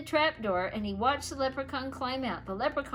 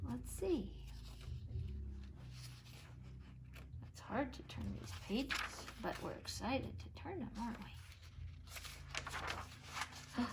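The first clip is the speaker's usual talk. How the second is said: soft, hushed teacher voice; hushed aside; 3 semitones lower, 13 dB quieter than usual. Shown as a demonstration. Teacher hushing the class while turning pages. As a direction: draw the kids in quietly.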